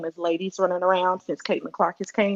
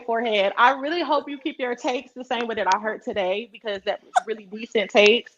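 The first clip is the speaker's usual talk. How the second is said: ordinary talk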